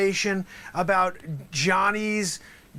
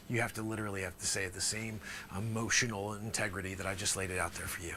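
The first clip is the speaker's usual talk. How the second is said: normal talking voice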